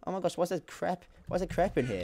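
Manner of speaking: high mock-dismayed voice; imagined dismayed player. Someone finding the crap he left, high mock dismay. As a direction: imitate a player baffled by bad loot. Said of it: "and they'll be like".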